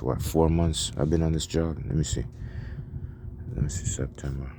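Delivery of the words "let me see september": whispered counting to himself; whispering. Real, not acted. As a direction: count the months under your breath. Counting months under his breath.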